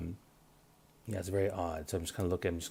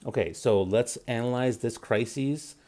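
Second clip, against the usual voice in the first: slow, measured problem-solver; taking the time; 3 semitones higher than usual. Retold or imitated. Showing listeners how to handle a crisis, slow and deliberate. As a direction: lay it out slowly and deliberately. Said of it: "take the time to analyze".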